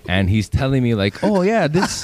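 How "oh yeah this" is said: imitating a Korean accent, pitched higher; mock Korean-accented shopkeeper; light Korean accent impression.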